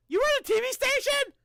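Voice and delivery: high, loud disbelief; can't believe a friend runs a TV station; surprised. Real, not acted. Hearing a friend runs a TV station, high surprised shout.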